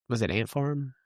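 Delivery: normal talking voice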